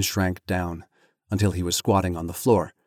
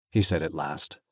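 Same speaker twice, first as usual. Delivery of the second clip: ordinary delivery, nothing marked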